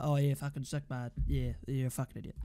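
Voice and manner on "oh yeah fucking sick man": low, unimpressed fake enthusiasm; deadpan mock cheer. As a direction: imitate a happy fan with no energy at all. Acts out requested fake happiness.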